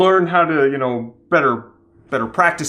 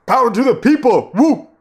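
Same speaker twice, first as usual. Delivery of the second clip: rally cry, high and loud; cheering; 8 semitones higher, 4 dB louder than usual. Pretend. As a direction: cheer it like a protest crowd. Mock cheer for the Kiwis.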